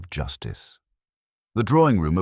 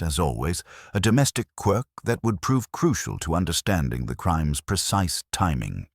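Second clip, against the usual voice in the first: usual speaking voice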